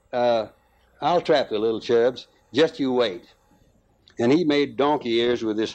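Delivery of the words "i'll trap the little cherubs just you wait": plain reading voice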